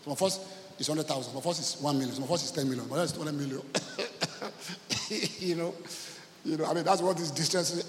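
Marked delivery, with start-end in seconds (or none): 3.7-5.3 quiet, unvoiced chuckle; laugh. Real, not acted. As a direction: chuckle softly at the money troubles. Laughs about money troubles.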